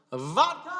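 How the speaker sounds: very high cry without much volume; mock screech. Man calls to the moon for vodka, mock screech. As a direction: imitate screeching up at the sky. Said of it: "he screeched to the heavens".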